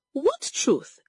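high, sharp question; shouted challenge. A narrator's reading. Mother challenges her daughter, half-shouted. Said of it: "Egodi shouted".

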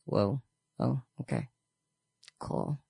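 low, hushed mock reaction; quiet surprise. Onlooker hearing him switch languages, quietly taken aback. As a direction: drop right down, taken aback.

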